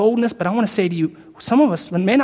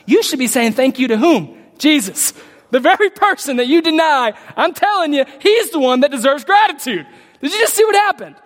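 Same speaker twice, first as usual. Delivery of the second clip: higher, louder preaching push; rising preaching; 8 semitones higher, 4 dB louder than usual. Acted. Preacher pressing hearers about Jesus, rising fervour. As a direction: drive the point home to the congregation.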